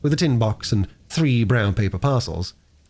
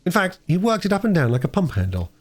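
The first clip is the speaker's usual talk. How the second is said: plain reading voice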